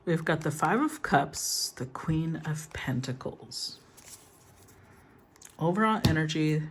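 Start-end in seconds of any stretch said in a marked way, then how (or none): 1.8-3.8 slow hushed whisper to herself. Real, not acted. Tarot reader naming a drawn card, whispered. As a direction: breathe the card name under her breath.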